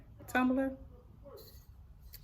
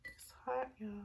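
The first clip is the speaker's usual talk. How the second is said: whisper; 4 semitones higher, 15 dB quieter than usual. Real, not acted. Whisper heard.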